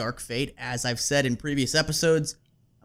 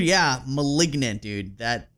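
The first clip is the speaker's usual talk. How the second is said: usual speaking voice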